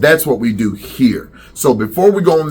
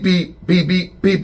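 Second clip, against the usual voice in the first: car horn beeps; 6 semitones higher than usual. Retold or imitated. Imitating car horns in traffic.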